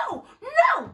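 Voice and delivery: high, loud imitated protest; mock scream. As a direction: squeal the no's. Girl resisting the bouncer.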